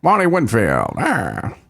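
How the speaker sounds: mock ballpark announcer; Vin Scully. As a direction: call out the player's name like an announcer. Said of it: "voice of the Dodgers".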